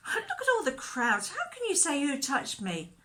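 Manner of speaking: high-pitched exasperated disbelief; baffled, voice leaping up; incredulous. Acted. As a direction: protest as if the question is absurd. Disciples question Jesus in disbelief.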